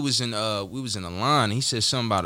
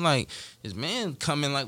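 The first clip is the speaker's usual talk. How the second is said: ordinary delivery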